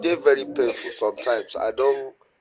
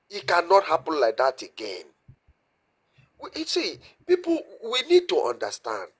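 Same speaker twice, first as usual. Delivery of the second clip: normal talking voice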